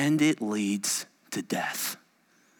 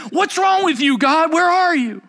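high, raised accusing cry; loud, high-pitched protest heavenward; crying out to God; 8 dB louder than usual. Acted. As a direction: call out to God in exasperation. Acted cry of complaint to God.

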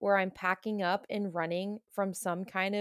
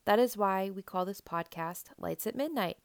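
ordinary delivery

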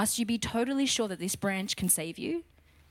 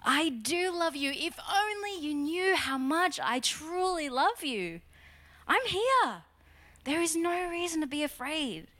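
tender reassurance; 6 semitones higher than usual. Retold or imitated. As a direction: soothe them as a loving Jesus. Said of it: "Jesus is saying".

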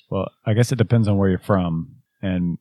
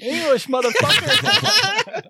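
movie quote; slower than usual. Retold or imitated. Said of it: "movie quote again".

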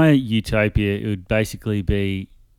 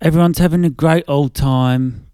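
semi-cockney impression; 5 semitones higher, 4 dB louder than usual. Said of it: "a semi-cockney one".

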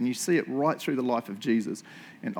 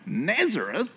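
higher-pitched, questioning disbelief; incredulous exclamation; 6 semitones higher, slower than usual. Acted. Nathanael hearing where Jesus is from, acted disbelief. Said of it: "exclaimed Nathanael".

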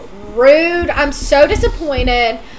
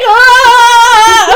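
short high fangirl shriek, louder; mock shriek; 6 semitones higher, 6 dB louder than usual. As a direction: squeal like a starstruck fan. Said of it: "I'm like".

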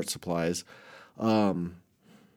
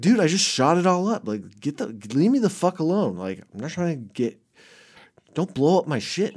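retold annoyed telling-off; 6 semitones higher than usual. Telling hobo off.

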